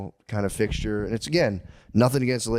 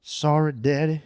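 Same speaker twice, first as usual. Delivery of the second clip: put-on southern girl's pleading accent, higher; southern daughter; 3 semitones higher, slower than usual. Daughter apologizing to her daddy, southern accent. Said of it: "if she's got that accent".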